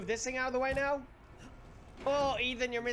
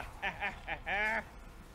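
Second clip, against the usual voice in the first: low, chopped laugh; staccato laugh; 7 semitones lower, 4 dB quieter than usual. Real, not acted. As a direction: laugh in a few short bursts. Laughs after being startled.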